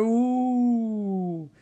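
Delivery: high, drawn-out spooky "ooh"; hammed-up haunting howl; mock ghostly ooh. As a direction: wail it like a pretend ghost. Acted ghostly warning, mocking.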